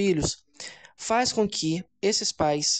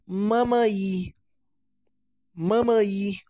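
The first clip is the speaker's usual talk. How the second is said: put-on child's first word; 2 semitones higher, much slower than usual. Shown as a demonstration. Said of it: "essa palavra que eu acabei de dizer".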